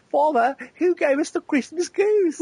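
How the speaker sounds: put-on very high-pitched English child impersonation; little boy's voice. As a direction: squeak it out like a small son asking Father. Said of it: "then his son Sam also did one".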